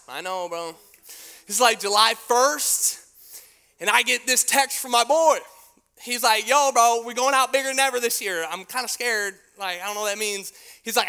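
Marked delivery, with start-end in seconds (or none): 6.5-10.5 loud, excited buddy, pitched up; hyped friend. Retold or imitated. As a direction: sell it like a pumped-up friend. Friend voiced, hyped text.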